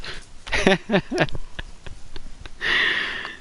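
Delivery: breathless silent wheeze of a laugh; wheezy laugh. Real, not acted. Laughing at own story.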